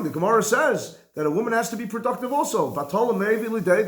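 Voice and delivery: normal talking voice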